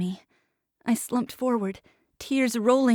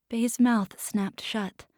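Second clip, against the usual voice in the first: ordinary delivery, nothing marked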